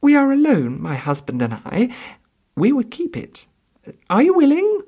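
high-pitched, tentative appeal; nervous lady's hopeful request; anxious woman pleading. A narrator's reading. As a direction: plead up high, just recovered. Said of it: "She recovered her breath and continued".

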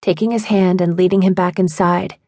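plain reading voice

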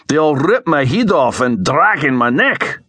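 high-pitched Scots protest; aghast. A narrator's reading. Alex fears the huge guardswomen, aghast Scottish protest. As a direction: protest in alarmed, broad Scots. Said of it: "Alex was aghast".

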